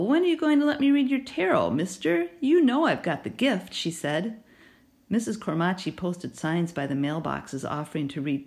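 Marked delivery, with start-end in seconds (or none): 0.0-3.7 raised, lightly voiced playful neighbor; teasing neighbor. A narrator's reading. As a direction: coax him like a fortune teller.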